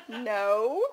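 measured, careful correction. A narrator's reading. Mother carefully correcting her toddler, careful tone. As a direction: say it carefully, drawing it out. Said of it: "I said carefully".

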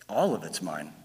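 low, gentle, calm voice of God; gentle voice of the Lord. Retold or imitated. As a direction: softly claim it all as a kind Lord. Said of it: "And the Lord's just gently".

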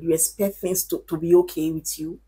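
ordinary talk

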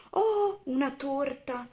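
high-pitched delighted exclamation; a child thrilled to spot a treat; exulting. A narrator's reading. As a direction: cheer it in a high storybook voice. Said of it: "esultò Timothy".

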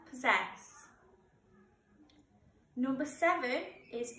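clear, measured teacher dictation voice; slow school dictation. Acted. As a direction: read each word out carefully for the class. Teacher reads spelling test words.

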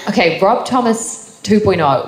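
slow, laughing through the name; amused, giggling start to the answer. Real, not acted. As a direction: still chuckling from the question. Amused by question about [name].

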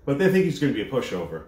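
ordinary delivery

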